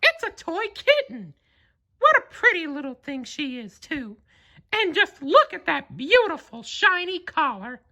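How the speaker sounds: high falsetto mouse, admiring; delighted Mickey. A narrator's reading. Mickey admiring the toy kitten, high and delighted. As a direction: gush over it like Mickey.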